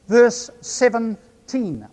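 "Verse seventeen" spoken slowly, each sound clear; said carefully. Shown as a demonstration. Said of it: "say it carefully so that my New Zealand accent doesn't obscure".

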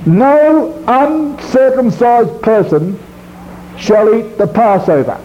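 NO lifted high and held, slow; repeating the law slowly for the congregation, NO stretched; slow stress on NO. Shown as a demonstration. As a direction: stretch out NO as a warning. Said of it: "I will emphasise certain words".

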